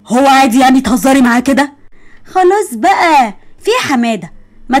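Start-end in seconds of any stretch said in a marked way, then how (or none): 2.2-3.3 high, slow wheedling; drawn-out whine. Acted. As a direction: stretch it out, coaxing. Pleading with drawn out. (دلع ومط في الكلام)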